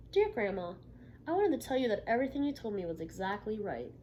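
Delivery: normal talking voice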